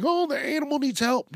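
high-pitched mocking concern; mock concerned tourist. Park visitor pretending to worry over an animal, mocking high voice. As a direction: put on a high, fretting onlooker voice.

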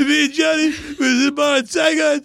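slurred, tearful action-hero monologue, imitated; Stallone. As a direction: mumble it like a choked-up war veteran, hammed-up. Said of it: "'Cause it's Stallone".

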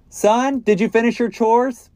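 acted parent hollering on arriving home; dad calling out. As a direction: call through the house to your son. Returning dad calls to his son.

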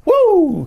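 loud, high hype whoop; a quick excited woo. Real, not acted. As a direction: whoop like the crowd just went wild. Hyped shout after the title.

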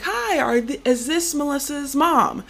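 high, bright phone-caller voice; chirpy cold call opener; a perky telemarketer checking who answered; bright caller greeting. Retold or imitated. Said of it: "I was like".

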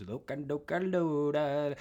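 slow, sing-song Ewok babble; Ewok accent. Retold or imitated. Ewoks handing stuff to each other, in Ewok accent. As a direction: babble like an Ewok passing things along. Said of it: "that was an Ewok accent".

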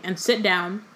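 ordinary delivery

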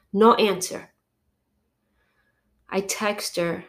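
normal talking voice

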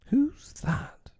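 high, breathless whisper; hushed, high-pitched query; whispered question. A narrator's reading. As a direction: whisper it as a startled challenge. Startled pirate challenges the cloaked figure.

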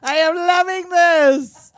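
loud, high, slow glee; delighted. Retold or imitated. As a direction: savor it loudly, thrilled. Acting delighted reverend.

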